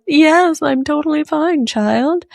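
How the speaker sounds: high, overly bright denial while teary; mock breezy reassurance. Mom insisting to her son she's fine, high and breezy. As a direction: brush it off in a sing-song.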